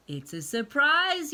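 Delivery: high, loud excited yell. A narrator's reading. Froggy yelling to his mother, high and loud. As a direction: shout it out happily. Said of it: "yelled Froggy".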